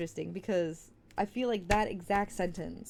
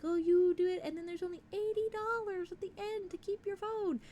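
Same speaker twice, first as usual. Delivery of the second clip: sing-song high mock salesperson voice, much higher; hammed-up high corporate voice; mocking phone company; 11 semitones higher than usual. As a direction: mimic the cheery company pitch. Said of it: "They're like".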